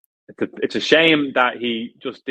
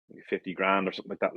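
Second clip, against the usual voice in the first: normal talking voice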